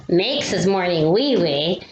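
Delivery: ordinary delivery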